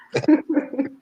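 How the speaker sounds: word breaks into a laugh. Real, not acted. Judge laughs through word.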